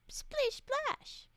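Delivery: high playful. Acted. Water creature entrance.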